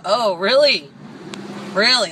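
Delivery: loud incredulous really; sarcastic disbelief. Retold or imitated. Quoting own comeback to the driver, loud and incredulous. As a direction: throw it back, doubting her. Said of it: "I go".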